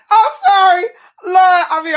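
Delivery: high, loud, nearly crying with laughter; helpless laughter. Real, not acted. Apologizing after reading a mocking comment, helpless laughter. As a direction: squeal the apology through real laughing.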